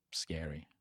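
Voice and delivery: ordinary talk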